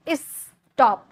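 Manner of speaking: स्टॉप broken into "is" and "top"; wrongly split syllables. Shown as a demonstration. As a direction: put a gap inside स्टॉप, "iss... top". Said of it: "ऐसे थोड़ी बोल रहा है".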